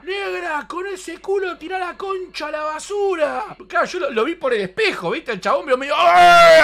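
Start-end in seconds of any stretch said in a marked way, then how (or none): 0.0-3.4 mock catcall shout. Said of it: "le grita". 6.0-6.6 loud, high mock shriek; retold shriek of fright; imitated startled yell. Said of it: "así gritar".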